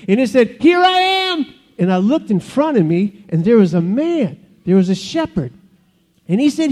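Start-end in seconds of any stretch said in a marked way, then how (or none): 0.6-1.5 high, meek little voice; wimpy voice. Acted. Shepherd answers the booming voice, wimpy voice. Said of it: "it was a little wimpier".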